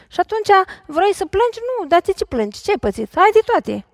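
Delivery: half-hearted parental scolding; imitating a scolding parent. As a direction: lightly voice the parent who says no to everything. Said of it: "N-ați auzit asta în casă?".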